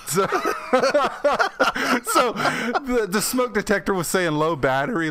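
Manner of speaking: real laugh easing into speech. Real, not acted. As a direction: get it out through leftover laughter. Cracking up after the punchline.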